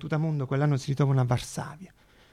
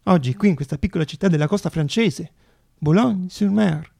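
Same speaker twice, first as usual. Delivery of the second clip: louder, measured address to the hall; formal speech; 5 dB louder than usual. Acted. Zamenhof addressing the congress, louder formal speech. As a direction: open the congress with ceremony.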